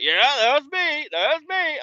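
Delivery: put-on very high, proud old-timer; squeaky geezer insisting it was him; old man voice. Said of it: "He's like".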